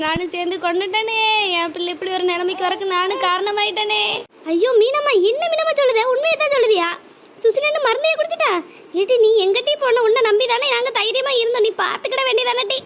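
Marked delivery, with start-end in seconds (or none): 4.5-8.5 disbelieving wail. Acted. Mother disbelieves daughter's poisoning. 9.0-12.8 high, raised accusing cry; anguished reproach. Acted. Mother blames friend.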